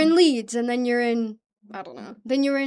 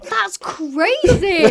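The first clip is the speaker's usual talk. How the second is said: amazed; 6 semitones higher, 5 dB louder, much slower than usual. Real, not acted. Amazed at huge gum pack.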